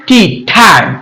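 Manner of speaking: time with the t pushed out as ঠ; aspirated T. Shown as a demonstration. Teacher showing pupils time with a pushed ঠ. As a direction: say time with a puff on the t, like ঠাইম. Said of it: "এইভাবে ঠেলা দিয়ে উচ্চারণ করব".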